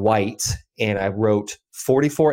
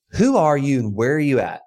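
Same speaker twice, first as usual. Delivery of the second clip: imitated Texan caller, higher-pitched; Texan accent; 5 semitones higher than usual. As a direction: quote a caller with a light Texan lilt. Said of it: "like, nice Texan accent".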